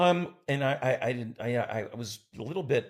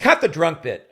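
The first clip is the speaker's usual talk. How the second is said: curt order; 5 semitones higher, 10 dB louder than usual. Retold or imitated. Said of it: "He says".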